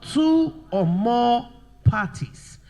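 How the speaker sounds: loud and very slow, spaced words; slow dictation. Shown as a demonstration. Teacher dictating the answer to the class, slow dictation. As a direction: stretch each word out for note-takers. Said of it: "Now let's write".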